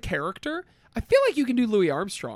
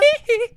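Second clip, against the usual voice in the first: high, loud falsetto "hee-hee"; playful high-pitched yelp; 7 dB louder than usual. Shown as a demonstration. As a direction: squeal a quick pop-star "hee-hee". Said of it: "You can do a [name]".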